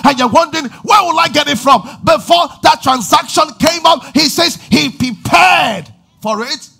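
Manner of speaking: loud, high, driving preacher's build; impassioned sermon climax, rising to a shout; full-force preaching. Real, not acted. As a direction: preach it hard and rising to the crowd.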